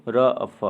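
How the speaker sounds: "र अ फ" said sound by sound. Shown as a demonstration. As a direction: break it into separate sounds, "र अ फ". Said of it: "इन शब्दों का उच्चारण नागरी लिपि में लिखिए". ("र अ फ" एक-एक ध्वनि अलग करके)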